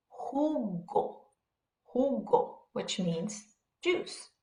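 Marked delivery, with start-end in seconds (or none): 0.1-2.6 "jugo" said hu-go, with an English H. Shown as a demonstration. Teacher models a Spanish example word for learners, J as English H. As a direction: open "jugo" on a breathed H sound. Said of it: "sounds like the H in English".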